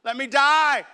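high, loud begging to die; injured man shouting to be left; loud plea. Retold or imitated. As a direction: cry out for them to let you die. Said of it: "and I said".

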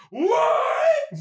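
very drawn-out high what; exaggerated shock. Narrator acting the final twist, drawn-out shocked cry. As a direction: stretch the word into a cartoonish cry.

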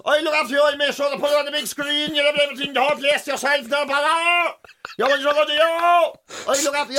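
lightly put-on Cork accent, pushy and generous; Cork lad imitation. Comedian imitating the Cork lad who offers to look after him, in a Cork accent. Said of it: "Does everybody at Cork speak like that?".

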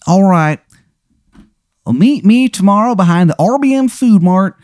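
put-on high, hammy Baltimore accent; terrible Baltimore accent. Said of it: "his terrible accent".

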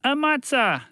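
high-pitched cry; shouting. A narrator's reading. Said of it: "shouted a young woman".

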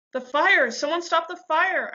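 high, loud, desperate cry; screaming. A narrator's reading. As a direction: scream for help against the burning. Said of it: "I screamed as it burned me".

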